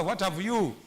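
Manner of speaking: ordinary talk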